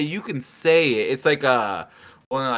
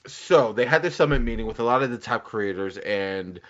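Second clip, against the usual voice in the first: ordinary talk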